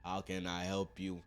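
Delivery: low, unfazed operator voice; emergency operator. Retold or imitated. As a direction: act a deep-voiced, routine phone dispatcher. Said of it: "Nine one one pick up the phone".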